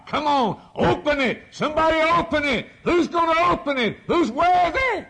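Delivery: high, loud preacher's shout; shouted challenge. Acted. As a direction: cry out the challenge to all creation. God's challenge, acted at full force.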